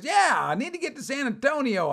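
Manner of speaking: high, insistent pleading voice; simple stranded traveler. Retold or imitated. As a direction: imitate a confused man needing help. Voicing the odd stranded traveler.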